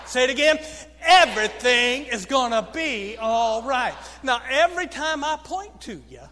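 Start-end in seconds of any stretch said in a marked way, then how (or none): 1.0-4.0 "Everything" punched loudly at its start; accent on Everything. Shown as a demonstration. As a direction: lead the phrase with an emphasized "Everything". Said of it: "it just means emphasize it".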